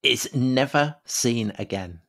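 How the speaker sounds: slowed right down, each word weighed; slow dramatic reveal. Acted. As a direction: let it land slowly, like a mystery.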